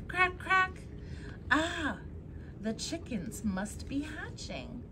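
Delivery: usual speaking voice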